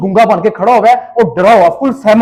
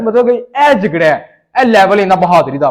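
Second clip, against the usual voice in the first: normal talking voice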